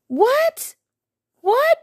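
high, loud disbelieving what; outraged what. Real, not acted. Outraged at boyfriend's reply, high shrieking disbelief.

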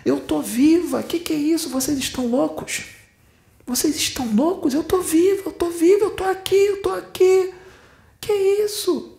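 very high, pleading, rising panic; a trapped spirit's high frantic plea; desperate dead girl. Retold or imitated. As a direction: cry out unheard, insisting she's alive. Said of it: "Sabe o que ela fala?".

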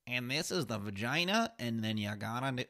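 lightly voiced nerdy guy, slow; a hint of a nerdy audiobook reader, slowly; mock nerdy reader, slowed down; nerdy narrator. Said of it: "this guy who's like".

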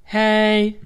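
loud goose-like honk of a hey. Retold or imitated. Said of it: "That's what you sound like".

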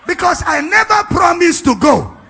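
high, shouted proclamation with drawn-out words; loud, slow, impassioned preacher's declamation; full-force preaching. Acted. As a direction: thunder it out as a divine promise.

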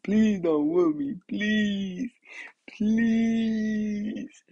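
high, drawn-out mock wail; retold tearful begging. Kid begging parent not to whoop, high mock-tearful wail. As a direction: beg in a long high whine, hamming up the tears. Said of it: "I bursted out into tears".

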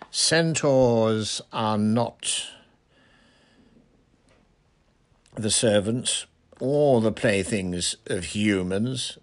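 slow, measured correction; deliberate words with long pauses; quiet rebuke. A narrator's reading. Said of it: "said Firenze quietly".

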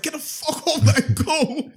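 high laughing mock yell to get out. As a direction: yell it high like a panicked guy chasing her off. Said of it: "He's like".

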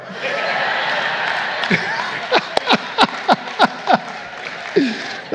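breathless wheezing laugh; preacher laughing hard at his joke; real silent laugh. Real, not acted. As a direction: crack up at your own punchline.

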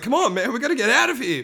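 comic panicky pleading; panicking. Retold or imitated. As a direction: plead nervously to leave.

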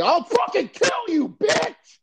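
high, loud screaming threat; mock furious threat. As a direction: scream it at her like a maniac. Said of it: "telling his fucking baby mommy".

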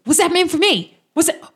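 high, loud indignant chatter; mock-offended and thrilled; high eager character voice; excited NPC. NPC reacts to missed attack.

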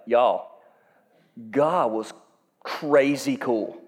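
low, slowed-down amazed drawl; awed aside. Acted. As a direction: let crazy cool sink in slowly. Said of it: "be like".

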